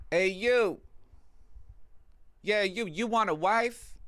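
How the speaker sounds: high, slow croaky crone calling out from behind the trash; cackling old hag luring a stranger, drawn out; old lady voice. Retold or imitated. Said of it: "old decrepit lady".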